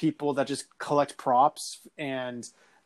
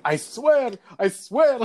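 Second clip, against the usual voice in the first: pleading insistence; 8 semitones higher, 5 dB louder, slower than usual. Retold or imitated. Scared worker insists to narrator.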